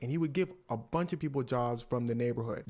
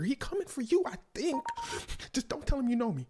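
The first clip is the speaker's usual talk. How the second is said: high, urgent warning; mock panic, pleading to be left out; scared friend; 11 semitones higher, 4 dB louder than usual. Michael's friend warning him on the phone, scared. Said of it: "Why you sound scared?".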